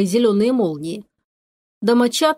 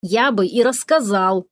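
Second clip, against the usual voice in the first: usual speaking voice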